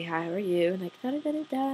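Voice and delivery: soft, lilting imitation of small talk; polite greeting. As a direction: put on light, well-mannered pleasantries. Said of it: "I'm pretty polite".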